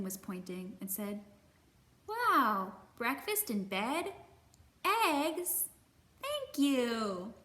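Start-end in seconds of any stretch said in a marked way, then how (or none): 2.1-7.3 excited, louder, higher on eggs; light up at a surprise breakfast; hungry bear thrilled at eggs; delighted cub. Acted.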